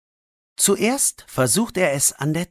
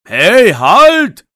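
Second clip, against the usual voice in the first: loud call to stop; shouting man; 8 semitones higher, 11 dB louder than usual. Acted. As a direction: bark the order across the garden. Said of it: "ruft eine Männerstimme". (lauter Ruf eines Mannes)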